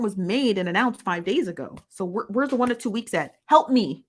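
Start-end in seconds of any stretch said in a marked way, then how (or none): 3.5-4.0 louder, higher cry for help; loud exasperated help me; exasperated plea. Real, not acted. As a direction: throw up your hands, help me.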